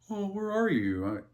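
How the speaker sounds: mock sweet parent. Dad mocks calling out to kids he can already see, sing-song and high. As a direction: ask like a parent faking not knowing. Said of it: "I'm not gonna be like".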